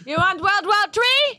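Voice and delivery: very high mock Jamaican lilt; exaggerated high-pitched Jamaican accent. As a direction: challenge them in a hammed-up Jamaican accent. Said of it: "you became Jamaican".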